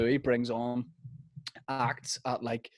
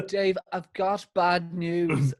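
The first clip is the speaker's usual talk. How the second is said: higher, very slow mock-tragic impression; Louis Walsh; 5 semitones higher, much slower than usual. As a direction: draw out the bad news as if about to cry. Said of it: "he looks like he's gonna cry".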